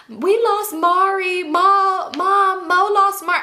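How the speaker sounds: put-on high, loud tattling kid wail; Cheryl. Little girl tattling to mom, high loud wail. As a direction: imitate a child hollering for mom, pitch way up. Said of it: "here goes Cheryl".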